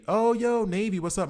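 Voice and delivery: high, loud hype greeting; light up and call out to a fellow Navy guy; excited shout-out. Real, not acted. Greeting a Navy viewer in chat, excited.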